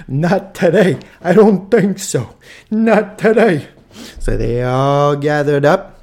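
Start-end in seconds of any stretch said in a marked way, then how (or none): 0.1-3.7 higher and louder, slow defiant call; loud tough-guy refusal; defiant shout. Retold or imitated. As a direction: shout it back at the Klan like a boxer. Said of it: "they're like".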